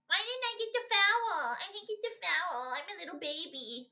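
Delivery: hammed-up crybaby imitation of a complaining star; mock crybaby. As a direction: whine like a sulking big baby. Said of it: "Here he goes again".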